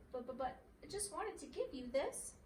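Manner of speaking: nervous stutter. A narrator's reading. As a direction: trip over the first words nervously. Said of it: "stuttered Dinky Dormouse".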